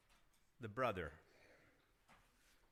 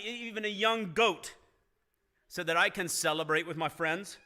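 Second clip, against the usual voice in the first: higher and louder, resentful complaint; angry older son; 5 semitones higher, 6 dB louder than usual. Acted. Older son confronting his father, bitter and loud. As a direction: hurl the grievance at your father. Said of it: "He was angry".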